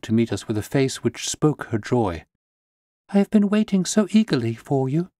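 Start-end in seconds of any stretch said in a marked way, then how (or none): 3.1-5.1 high, eager greeting in a raised voice; eager cry. A narrator's reading. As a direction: greet them with relief and eagerness. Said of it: "she cried".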